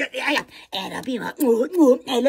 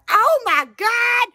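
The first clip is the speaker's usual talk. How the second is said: high, slow, loud oh my God; drawn-out exclamation of dismay; dramatic oh my God; 8 semitones higher, 5 dB louder, much slower than usual. Retold or imitated. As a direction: stretch it out in mock dismay. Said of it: "So I was like".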